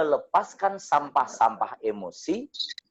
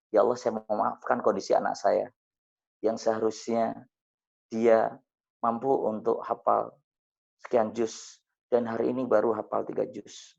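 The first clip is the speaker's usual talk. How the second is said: guided prayer; 4 dB quieter than usual. Acted. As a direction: murmur the prayer as a model for the audience. Leads a forgiveness prayer.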